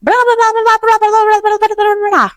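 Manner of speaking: fangirling at a star, breathless with excitement; gushing fan. Retold or imitated. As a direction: squeal it like a fan meeting their idol. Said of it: "I was like".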